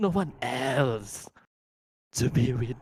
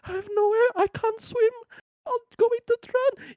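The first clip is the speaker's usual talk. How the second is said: put-on panicked child; 5 dB louder than usual. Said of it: "the child is going to be like".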